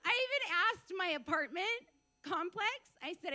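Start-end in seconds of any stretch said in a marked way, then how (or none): none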